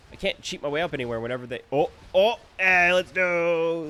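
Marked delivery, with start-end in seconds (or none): 1.7-3.9 loud, slow, high whoop; triumphant cheer. Real, not acted. Player cheering after cheating up the cliff. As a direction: celebrate the climb.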